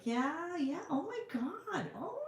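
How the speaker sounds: high, soft, drawn-out swooning; hammed-up flirty gushing; playful flirting. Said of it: "I'm gonna flirt".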